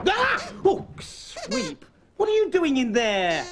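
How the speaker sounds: sudden high startled yelp; startled cry. Acted. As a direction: jump at the sudden surprise. Sweep pops up in album.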